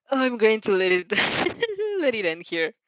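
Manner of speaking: ordinary delivery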